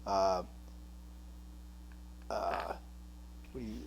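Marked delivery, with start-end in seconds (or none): none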